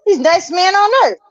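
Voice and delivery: defensive. Retold or imitated. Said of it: "I said".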